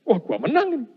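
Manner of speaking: high, cocky victory thought; preacher recalling his winning moment; gleeful triumph. Retold or imitated. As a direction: savour the win. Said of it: "inner thought of triumph".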